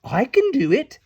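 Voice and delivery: shrugging fairy volunteering; casual shrug. A narrator's reading. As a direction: toss it off with a shrug. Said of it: "Tink said with a shrug".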